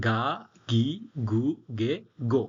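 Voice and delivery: "গা, গি, গু, গে, গো" as separate syllables with voiced g; voiced kana demo. Shown as a demonstration. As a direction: recite "গা, গি, গু, গে, গো" one by one, each starting on hard g. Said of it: "নরম থেকে ভারী সাউন্ডে বদলে যায়".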